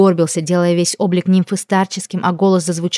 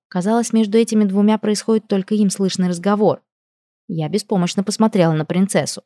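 ordinary delivery

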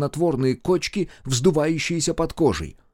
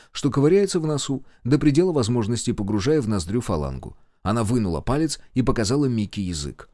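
ordinary delivery, nothing marked

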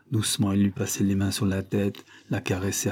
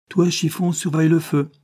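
high, raised voice giving orders; brisk order; 8 semitones higher, 6 dB louder than usual. Acted. Zélie ordering Chiffon about on leaving, commanding. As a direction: call out a chore to the child.

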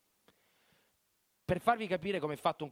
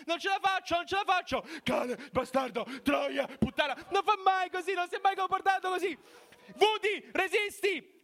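frantic shouting; 13 semitones higher, 3 dB louder than usual. Retold or imitated. Chaos of voices retold.

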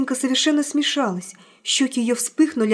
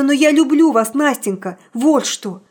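loud, rising declaration of love; heartfelt outburst; impassioned confession; 3 semitones higher, 6 dB louder than usual. Acted. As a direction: blurt out love at full voice.

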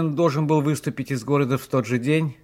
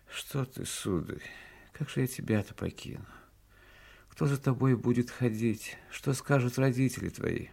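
softly voiced, worried old retainer; a hint of a fretting old servant, kept soft; lightly voiced old servant; 9 dB quieter than usual. Old servant Savelich objects.

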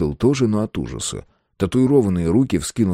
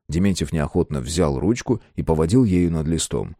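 ordinary delivery, nothing marked

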